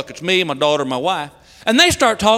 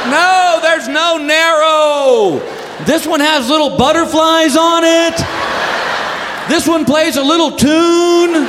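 frantic exasperation; 3 semitones higher than usual. Acted. Husband on phone from the pad aisle, exasperated wail. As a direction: wail each discovery in exasperation.

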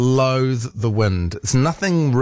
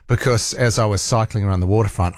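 normal talking voice

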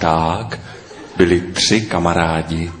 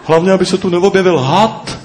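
worried; 9 semitones higher, faster than usual. A narrator's reading. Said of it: "strachoval se pták Noha".

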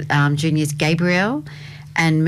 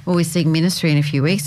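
normal talking voice

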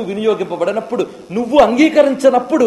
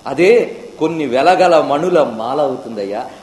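ordinary delivery

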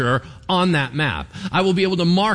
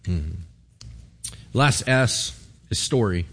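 ordinary delivery

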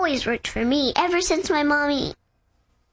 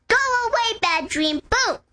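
mock loud shout; 6 semitones higher than usual. Shown as a demonstration. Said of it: "you just say really loud".

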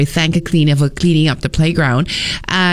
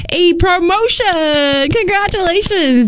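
excited; 12 semitones higher, slower than usual. Acted. Excitedly reading a listener's good news.

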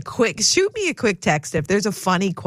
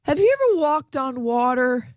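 lightly imitated child calling out, higher pitch; son calling out; 8 semitones higher than usual. Son on the couch calls to mom in the kitchen, mild imitated yell. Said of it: "he kinda yells out".

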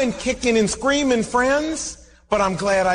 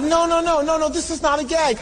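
insistent protest; 8 semitones higher than usual. Retold or imitated. Insisting to tax clerk.

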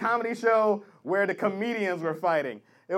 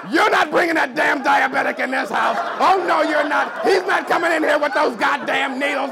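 loud, high shouting dad; outraged father; 4 semitones higher, 6 dB louder than usual. Retold or imitated. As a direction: bellow like a furious protective father. Father forbidding daughter's boyfriend.

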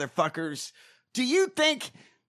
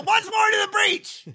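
booming theatrical shout, much higher; bellowed Shakespeare; 12 semitones higher than usual. Pretend. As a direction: roar the line like a stage king. Shouting Shakespeare, Brian Blessed style.

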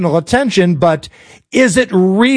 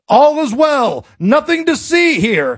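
high mock reassurance; Republicans insisting all is well; 5 semitones higher than usual. Host mocking Republicans who ignore the scandal, high singsong denial. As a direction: imitate officials waving trouble away, pitched high. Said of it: "the Republicans were like".

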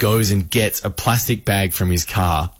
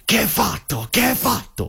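high-pitched mock Italian yelling; yelling in Italian, pitched high without real volume; retold yell; 10 semitones higher than usual. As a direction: imitate an irate Italian man yelling, pitch jumping up. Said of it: "starts yelling at him".

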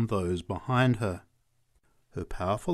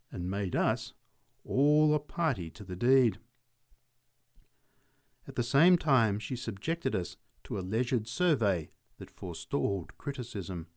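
ordinary delivery

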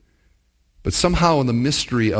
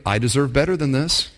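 ordinary talk, nothing marked

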